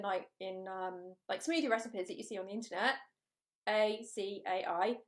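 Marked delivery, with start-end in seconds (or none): 3.7-5.0 A C A I spelled letter by letter, slowly; spelling A C A I before saying it. Shown as a demonstration. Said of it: "If you didn't know how to say that word".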